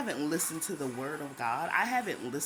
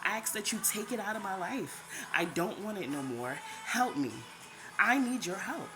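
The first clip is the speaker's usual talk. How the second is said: prayer; 3 semitones higher than usual. Retold or imitated. Retold shower prayer.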